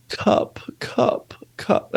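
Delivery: put-on high, hushed little-kid voice, breathy and pleading; crying toddler asking for her cup. Dad imitating his crying daughter at night, high and hushed. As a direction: whimper cup like a sleepy crying one-year-old. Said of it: "She goes".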